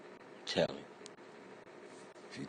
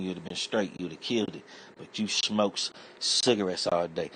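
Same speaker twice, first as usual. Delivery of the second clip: ordinary talk, nothing marked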